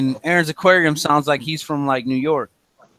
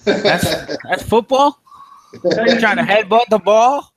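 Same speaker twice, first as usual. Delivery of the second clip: higher-pitched incredulous questions; 5 semitones higher, 3 dB louder than usual. Real, not acted. American mocking soccer to British fans, incredulous. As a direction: scoff in rising disbelief.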